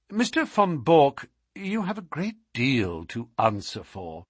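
lightly voiced detective, reproving his captive; a hint of a lofty sleuth taking stock; Holmes. A narrator's reading. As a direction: voice the detective lightly, with lively pitch.

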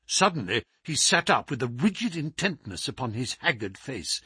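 usual speaking voice

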